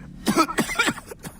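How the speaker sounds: a dying man's rasping cough; pained cough. Acted. Wounded foe coughs while gloating, pained cough. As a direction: cough weakly between words.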